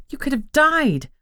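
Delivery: hissed. A narrator's reading. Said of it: "I hissed".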